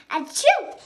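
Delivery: high loud sneeze mid-sentence; sneezing. Acted. Gerald can't stop sneezing while talking to Piggie, loud sneeze. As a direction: let the sneeze take over the words.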